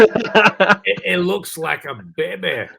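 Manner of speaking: loud, high mock-Scottish movie villain voice; Fat Bastard. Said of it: "I felt like fat bastard from Austin Powers".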